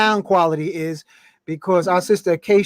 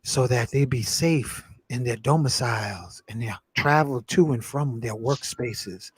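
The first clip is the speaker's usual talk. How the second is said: invocation prayer; 5 semitones lower, 4 dB quieter than usual. Acted. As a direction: pray it out like a ceremony leader.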